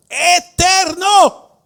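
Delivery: high, slow, loud cry of eterno; shouted word. Shown as a demonstration. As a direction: ring out eterno high and long. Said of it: "Diga la palabra, eterno".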